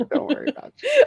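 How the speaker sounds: high squeal of laughter through the words; laughing. Real, not acted. Teasing Hayley about her book pile, squealing with laughter. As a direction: laugh it out while reassuring.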